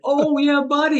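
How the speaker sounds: high, loud fake dawning surprise; mock realization. Pretend sitter suddenly remembering the dog, hammed-up surprise. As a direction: act like it just clicked, way up high. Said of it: "I'm like".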